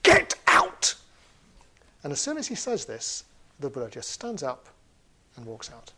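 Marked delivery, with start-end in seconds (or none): 0.0-1.0 explosive high-pitched shout; full-force troll yell; angry shout. Acted. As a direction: bellow it at the intruder. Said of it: "he shouts at the Buddha".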